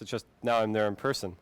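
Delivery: usual speaking voice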